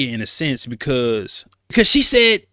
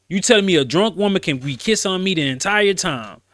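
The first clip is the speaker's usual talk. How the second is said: normal talking voice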